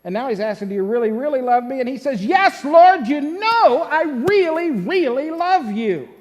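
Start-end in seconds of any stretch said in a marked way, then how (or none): 0.7-1.8 rattled-off retold question; quick recap. Preacher recapping what Jesus asks Peter, quick recap. 2.2-6.1 loud, higher-pitched insistence; hurt and annoyed. Acted. Peter answering Jesus for the third time, hurt and annoyed. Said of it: "a little hurt and annoyed by this".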